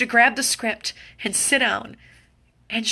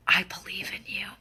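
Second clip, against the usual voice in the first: tender belief; 6 dB quieter than usual. Real, not acted. Tender repeated affirmation.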